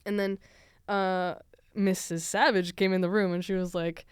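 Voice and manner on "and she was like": normal talking voice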